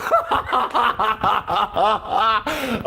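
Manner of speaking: soft breathy laugh. Real, not acted. After Robert's reply, soft breathy laugh.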